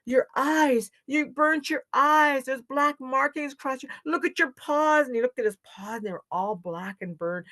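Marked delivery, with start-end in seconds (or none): 0.1-5.1 high-pitched alarm. A narrator's reading. Animals show Raccoon the burns, alarmed. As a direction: point it out in high dismay.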